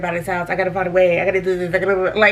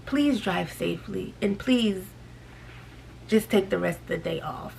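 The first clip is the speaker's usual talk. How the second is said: soft polite farewell; 3 semitones lower, 10 dB quieter than usual. Retold or imitated. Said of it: "I said".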